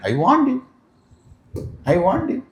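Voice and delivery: high-pitched little reminder; high I-told-you-so; child's taunting warning. Acted. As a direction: pipe it up high, twice over.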